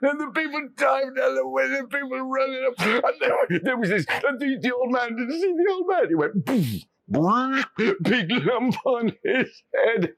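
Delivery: high, laughing through the words; a boy delighted with his prank; gleeful. A narrator's reading. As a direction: giggle while telling it. Oliver gleefully recounts prank.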